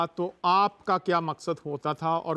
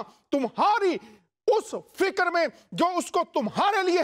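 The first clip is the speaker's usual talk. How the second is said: preaching; 4 semitones higher than usual. Acted. Pastor preaching at full force.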